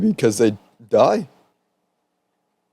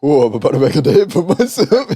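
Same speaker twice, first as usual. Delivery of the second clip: laughing through a Louisiana accent impression; playful half-laughing Louisiana accent; 3 semitones higher than usual. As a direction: giggle while putting on a Cajun Louisiana accent. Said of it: "such a hard accent to do".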